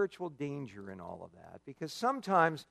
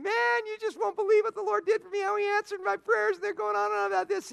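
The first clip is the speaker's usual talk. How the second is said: much higher, gushing glory voice; gushing churchgoer. Retold or imitated. Beaming churchgoer at fellowship gushing, high-pitched. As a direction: gush about answered prayers. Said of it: "they're saying".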